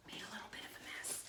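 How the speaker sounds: breathy whisper under the breath; a quick whispered aside about the mess. Real, not acted. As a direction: mutter it to yourself, barely voiced. Aside muttered while cooking.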